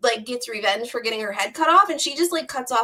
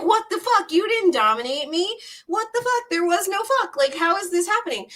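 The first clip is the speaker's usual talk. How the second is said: much higher, indignant mock outrage; outraged Set; 7 semitones higher than usual. Set protests to the judging gods, higher outraged voice. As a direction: sputter in disbelief at being tricked.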